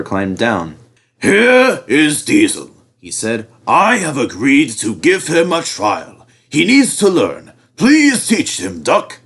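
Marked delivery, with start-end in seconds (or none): 1.2-9.2 Fat Controller. A narrator's reading.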